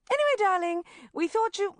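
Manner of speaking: bubbly mum bursting with news; mother ringing her daughter at work. Acted. Mum on phone.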